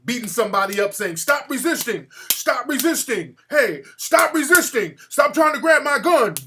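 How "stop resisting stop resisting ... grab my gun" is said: loud, high barked orders; raised, building imitation of police shouting; mock police yell. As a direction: shout it like a cop putting on a show. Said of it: "to yell out certain things".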